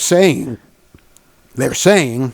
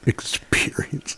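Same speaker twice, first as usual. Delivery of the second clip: whisper; 2 semitones lower, 10 dB quieter, slower than usual. Pretend. Mock luxury whisper of brand word.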